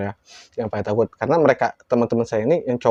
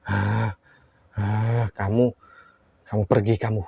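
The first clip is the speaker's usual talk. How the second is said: possessed woman; 4 dB quieter, slower than usual. Retold or imitated. Possessed woman pointing at teller, imitated ominous command. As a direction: breathe out, then slowly order them away. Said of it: "Kata, kata dia begitu sambil nunjuk".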